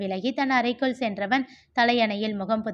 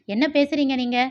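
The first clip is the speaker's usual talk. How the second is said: higher, louder angry challenge to his brother; angry retort; 4 semitones higher, 4 dB louder than usual. A narrator's reading. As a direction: demand it in anger. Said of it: "கோபத்தில் கத்தினான் கிரி". (கோபமான கேள்வி)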